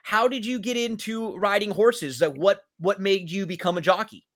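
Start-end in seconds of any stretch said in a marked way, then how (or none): none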